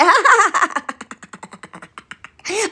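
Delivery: long, loud high laugh; drawn-out gloating cackle; taunting laugh. Acted.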